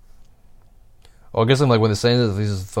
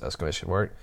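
ordinary delivery

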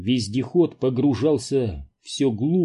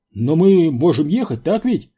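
higher, louder anxious appeal; worried question; 5 semitones higher, 6 dB louder than usual. A narrator's reading. Паунд asks the driver if they can drive, worried. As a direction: ask it anxiously, begging a yes. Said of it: "обеспокоенно спросил Паунд".

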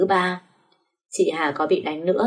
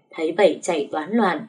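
ordinary delivery, nothing marked

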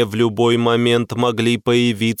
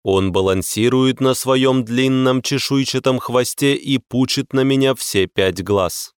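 plain reading voice

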